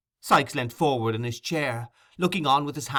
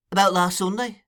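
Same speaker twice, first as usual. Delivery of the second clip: raised, puzzled echo; considering; 7 semitones higher, 4 dB louder than usual. A narrator's reading. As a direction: repeat it questioningly, thinking back.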